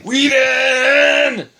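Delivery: high, loud hype call; excited shout. Acted. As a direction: belt out the name like an announcer. Hyping up a favourite director.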